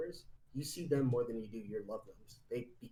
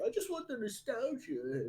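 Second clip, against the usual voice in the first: whiny gamer voice; 12 semitones higher than usual. Retold or imitated. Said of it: "their gamers are just like".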